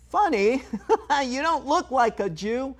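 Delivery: high, laughing mock voice. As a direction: squeak it out like a clueless stranger. Mocking a stranger's remark.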